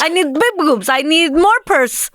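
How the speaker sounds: hammed-up Russian woman demanding; Russian accent. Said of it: "Russian accent".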